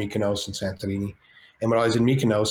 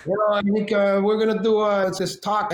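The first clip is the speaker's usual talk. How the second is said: higher, louder put-on De Niro voice; De Niro impression; 9 semitones higher, 3 dB louder than usual. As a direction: do Bobby's voice drifting over the hot tub. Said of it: "I hear this voice. It sounds awfully familiar".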